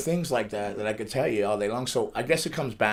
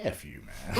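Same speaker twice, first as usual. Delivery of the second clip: laughing mock brush-off; 7 semitones lower, 11 dB quieter than usual. Retelling brushing off stranger.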